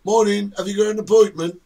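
bright, loud welcome at the desk; a chirpy receptionist greeting a walk-in; cheery receptionist. Retold or imitated.

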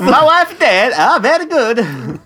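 high, hammed-up foreign accent; comic mock-foreign accent, drawn out at the end; Borat. Answering co-host's bank bit, Borat accent. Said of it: "That's more Borat".

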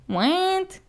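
high shocked what; a sudden shocked squeak; surprised what. Retold or imitated. Shocked reaction.

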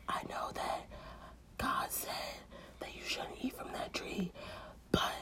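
whispered temptation from the snake. Acted. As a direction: lure her in a whisper.

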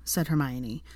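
usual speaking voice

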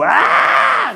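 high, loud mock shriek; sudden full-throated comic yell; loud scream. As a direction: imitate an audience member shrieking. Imitates an audience member's reaction.

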